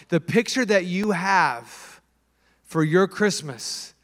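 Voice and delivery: normal talking voice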